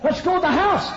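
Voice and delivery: loud, high, brusque command; shouted order. Retold or imitated. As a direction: holler it at the guest preacher. Gruff pastor orders guest home.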